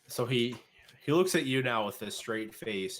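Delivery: usual speaking voice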